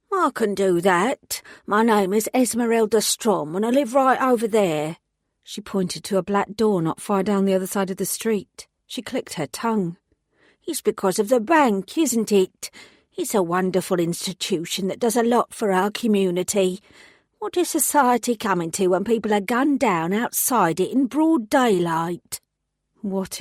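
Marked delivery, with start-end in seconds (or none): 0.1-5.0 elderly London woman, lightly voiced; London accent. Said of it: "she spoke with a London accent". 10.7-22.4 put-on indignant elderly London woman; London accent. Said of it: "she spoke with a London accent".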